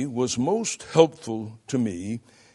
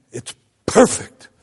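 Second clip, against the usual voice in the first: "perfect" popped loud and high on the P; explosive ictus; 7 semitones higher, 10 dB louder than usual. Shown as a demonstration. Preacher imitating a woman's praise, "perfect" with an explosive P. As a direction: hold back, then let "perfect" explode. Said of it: "holding back before saying that P".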